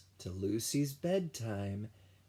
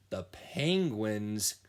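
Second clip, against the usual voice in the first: plain reading voice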